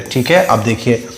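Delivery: usual speaking voice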